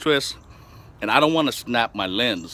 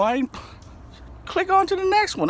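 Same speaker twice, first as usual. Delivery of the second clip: mock outburst. Mock yelling at viewers.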